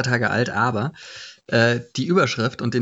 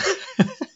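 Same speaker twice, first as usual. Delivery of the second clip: high squeaky laugh. Real, not acted. Laughs at the headline.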